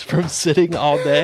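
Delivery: slow words through a laugh. Real, not acted. Joking with his friend about strip club, laughing. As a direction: crack up while finishing the joke.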